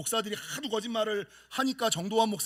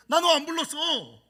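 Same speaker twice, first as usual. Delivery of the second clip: put-on higher, louder old father; father's reply; 7 semitones higher, 6 dB louder than usual. Father telling son he never called, higher. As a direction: play the puzzled father. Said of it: "그러니까".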